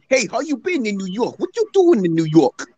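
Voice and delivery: high-pitched mock New York accent. Showing students how New Yorkers talk, mock New York accent. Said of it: "they talk like this".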